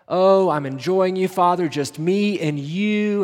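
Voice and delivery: mock smug delight. Imagined Jesus basking on the mountain, mock smug delight. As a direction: play it as a gloating insider.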